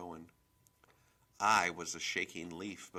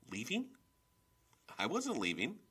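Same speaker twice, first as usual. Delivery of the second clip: stunned disbelief; 7 semitones higher than usual. A narrator's reading. Stunned girl hears the news.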